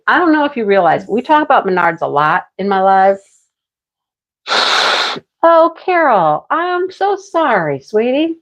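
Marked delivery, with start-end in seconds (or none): 5.5-8.4 ache for her in a slow, high coo; sympathetic. Real, not acted. To Carol who can't sleep, a slow, high sympathetic coo. Consoling a viewer in chat.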